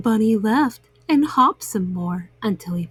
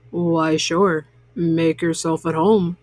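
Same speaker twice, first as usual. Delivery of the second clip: deep, easy bear welcome; a deep bear voice, welcoming; low bear voice; 7 semitones lower than usual. A narrator's reading. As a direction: sink low into a big friendly bear.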